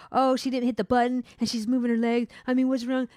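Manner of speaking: mildly whiny mock complaint; imitating complaining coworker. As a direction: mimic a coworker listing grievances. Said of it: "the girl was complaining about".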